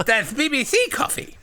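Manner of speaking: very high simpleton voice; high, silly lisping retake; full-on childlike John. Acted. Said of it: "John says".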